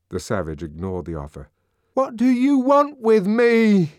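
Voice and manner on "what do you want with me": anguished demand. A narrator's reading. Savage confronts grinning crowd.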